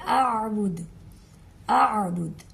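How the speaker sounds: اعبد with a clear hamza start and a throaty ain; careful اعبد; hamza then ain. Shown as a demonstration. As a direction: separate the hamza and ain sounds. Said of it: "حمزہ اور عین کی آواز میں فرق رکھنا ہے".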